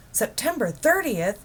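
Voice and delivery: suddenly excited, the date clicking; husband realizing the broadcast is tonight. Acted. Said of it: "in an excited tone".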